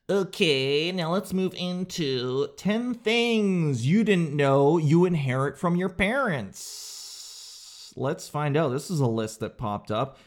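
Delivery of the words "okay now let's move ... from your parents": slow, drawn-out host announcement; segment announcer. Acted. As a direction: stretch out the segment title theatrically.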